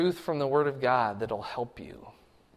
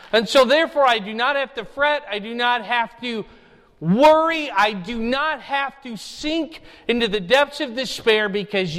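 fervent prayer to God, higher and louder; voiced prayer; 10 semitones higher, 7 dB louder than usual. Believer praying through hard times, earnest lightly acted prayer. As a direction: plead it like a believer in trial. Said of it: "You say".

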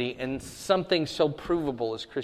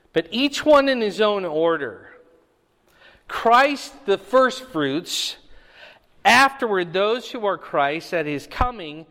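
louder, raised preaching read of the passage; scripture reading; 2 semitones higher, 4 dB louder than usual. Acted. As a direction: proclaim the verses to the congregation. Reads scripture aloud in sermon.

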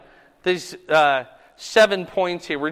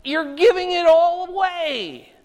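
higher, pleading, heartbroken; heartbroken plea; 9 semitones higher, 4 dB louder than usual. Retold or imitated. Jesus pleading with cities that reject him, heartbroken. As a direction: beg them not to throw it away. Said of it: "He's not mad at them. He's like".

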